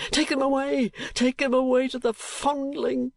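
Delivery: high raging command; father raging over the babies, wild; wild rage. A narrator's reading. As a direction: hurl the order out. Kenwigs raging on.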